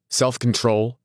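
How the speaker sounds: affronted, disbelieving repeat; incredulous. A narrator's reading. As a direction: throw it back in disbelief. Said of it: "repeated Tom incredulously".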